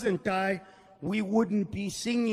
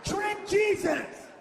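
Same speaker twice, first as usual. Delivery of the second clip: conspirator shout; 5 semitones higher than usual. Pretend. Acted conspirator shouting plans.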